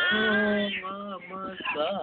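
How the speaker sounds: normal talking voice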